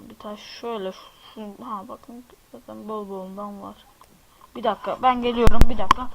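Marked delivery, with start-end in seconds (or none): 4.6-6.1 a quick yell to someone in the room; loud call away from the mic. Real, not acted. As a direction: shout fast, turned away from the mic. Calls out leaving the mic.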